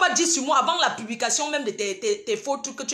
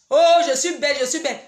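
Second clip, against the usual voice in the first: mocking boast; 5 dB louder than usual. Mocking rivals' Facebook boasts.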